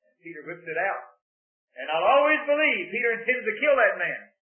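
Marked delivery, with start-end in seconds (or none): 1.7-4.3 rising preacher's emphasis; building sermon force; preaching with force. Real, not acted. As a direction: drive the conviction home from the pulpit.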